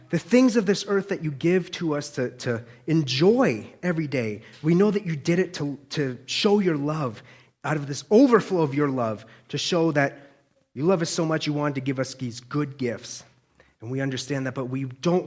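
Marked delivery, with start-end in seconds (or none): none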